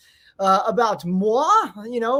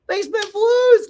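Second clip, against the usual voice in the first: shout-out; 12 semitones higher, 8 dB louder than usual. Real, not acted. Shouting out a viewer name.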